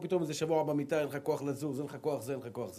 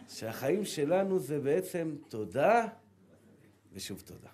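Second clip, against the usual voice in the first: slow meaningful reading; slower than usual. Shown as a demonstration. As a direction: savour each word like a revelation. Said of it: "העמקות של המשורר".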